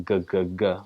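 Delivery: "g-g-g" as repeated hard g sounds. Shown as a demonstration. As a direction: drum out "g-g-g" with a hard g. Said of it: "with a G sound".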